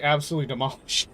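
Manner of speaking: normal talking voice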